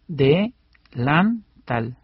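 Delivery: slow "de-lan-tal", each syllable separate; slow teaching split of "de-lan-tal". Shown as a demonstration. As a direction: pause between "de", "lan" and "tal". Breaks the word into syllables.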